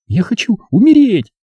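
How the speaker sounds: put-on high girlish despairing cry; desperate girl. Zaza in despair.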